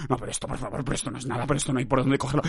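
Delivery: low, quiet mock grumbling; complaining listener. Podcaster quoting a critical listener, low mock grumble. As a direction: imitate a critic mumbling objections. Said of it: "Que vienes aquí a decir".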